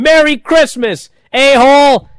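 loud, high, drawn-out yell; imitating Cody screaming. Host imitates Cody ending the brawl, screaming. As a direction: holler it slowly at full volume. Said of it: "Cody screaming".

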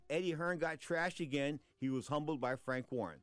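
ordinary talk, nothing marked

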